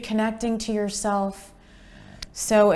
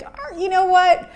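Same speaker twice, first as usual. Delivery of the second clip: much higher and louder exclamation; playful teasing family voice; hint of a sceptical relative; mock family excitement; 11 semitones higher, 6 dB louder than usual. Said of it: "they're like".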